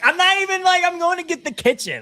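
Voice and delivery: high pleading pet voice; talking to the cat. Retold or imitated. Telling the scared cat she's only going to the kitchen, high and pleading. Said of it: "And I'm like".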